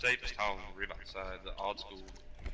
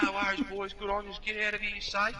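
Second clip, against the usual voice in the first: higher-pitched imitation of a fireman; reassuring firefighter; 9 semitones higher than usual. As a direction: send the boys off, believing them. Acting the reassured fireman's reply.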